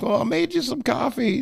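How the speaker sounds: high-pitched grandma imitation; grandma's voice. Grandma offering grandson evening coffee, imitated high-pitched. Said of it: "That's how she talked".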